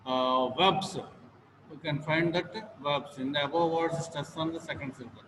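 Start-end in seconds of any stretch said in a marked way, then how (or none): none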